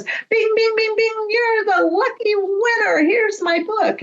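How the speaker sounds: high, bright-eyed prize announcer, mock ding-ding; playing a game show host crowning a winner. Author hands her the book, hammed-up game show host.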